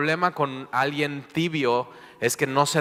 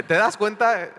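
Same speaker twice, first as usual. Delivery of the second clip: amazed question; 8 semitones higher, 3 dB louder than usual. Acted. Preacher pointing out everyday absurdity.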